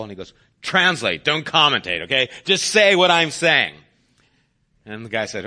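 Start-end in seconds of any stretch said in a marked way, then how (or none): 0.6-3.7 louder, exasperated command to a helper; impatient order. Acted. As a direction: snap the orders out firmly. Acted impatient order to translator.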